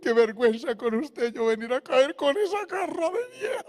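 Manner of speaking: high, tearful imitation of a sobbing young man; young man crying to his pastor in shame, voice cracking. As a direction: play the weeping caller on the phone. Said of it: "llorando me dijo".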